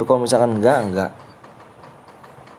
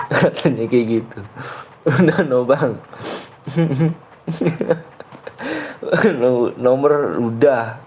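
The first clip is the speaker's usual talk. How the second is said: talking through giggles; real giggling. Real, not acted. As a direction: try to speak while cracking up. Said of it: "Jadi ketawa akunya nih".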